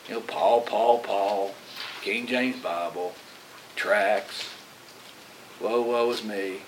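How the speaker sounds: low, soft, weary mumble of a bored listener; a dull, dragging complaint about Ephesians again; bored churchgoer. Retold or imitated. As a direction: sigh it out like a tired pew-sitter. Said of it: "like a knot on a log".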